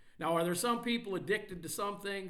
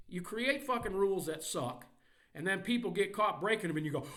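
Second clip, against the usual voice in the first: normal talking voice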